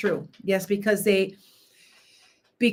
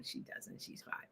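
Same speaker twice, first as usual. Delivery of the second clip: whispered aside; 25 dB quieter than usual. Real, not acted. Aside about five-year-old granddaughter.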